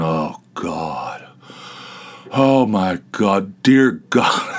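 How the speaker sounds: a man on the pot, straining and begging God; toilet straining. Pretend. Imagined bar patron straining in the bathroom, slow strained groans. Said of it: "I could just imagine somebody in there going".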